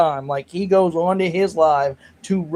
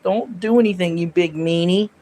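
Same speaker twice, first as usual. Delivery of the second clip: a weakling's pretend telling-off; whiny fake scold, a little louder; mock wimpy scolding; 4 dB louder than usual. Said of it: "slap 'em like this and be like".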